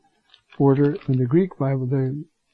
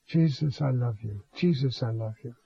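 soft, hushed loving prayer, much softer; tender prayer; 4 semitones lower, 7 dB quieter than usual. Shown as a demonstration. Priest praying tenderly to Jesus while falling asleep, hushed. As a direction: murmur it gently like a bedtime prayer.

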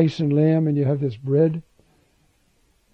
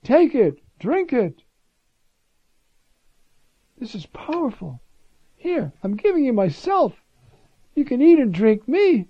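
much higher and louder, fervent sermon voice; impassioned preaching; 8 semitones higher, 6 dB louder than usual. Acted. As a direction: preach it out with full fire. Preaching at full force.

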